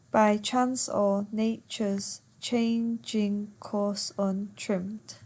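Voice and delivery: slow, syllable-by-syllable verse reading; per suku kata. Shown as a demonstration. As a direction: count out the line, one beat per syllable. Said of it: "Once again, ten syllables".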